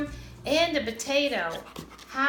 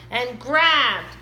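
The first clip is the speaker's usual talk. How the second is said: plain reading voice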